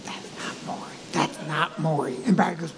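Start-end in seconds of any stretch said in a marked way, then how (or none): 0.0-0.9 barely audible whisper; hushed, urgent aside; discreet whisper. Retold or imitated. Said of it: "So I lean over to Barry". 1.1-2.2 low, softened undertone; discreet murmur. Retold or imitated. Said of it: "trying to be very discreet".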